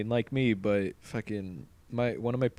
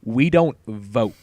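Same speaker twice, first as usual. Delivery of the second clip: firm loud insistence; angry man; 3 semitones higher, 8 dB louder than usual. Retold or imitated. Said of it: "And he's like".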